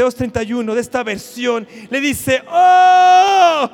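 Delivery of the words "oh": very loud, high, sustained "Oh"; fervent cry. Acted. Preacher reads Moses interceding for Israel, fervent cry. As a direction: pour fervour into a long cry. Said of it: "había que ponerle fervor".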